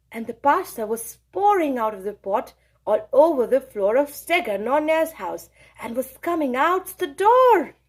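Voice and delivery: plain reading voice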